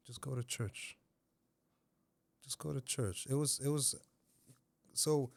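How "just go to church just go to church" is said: softly spoken urging; gentle prompt, volume dropped; quiet inner voice. Retold or imitated. Inner voice guiding a lost man, soft. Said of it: "this time it was saying".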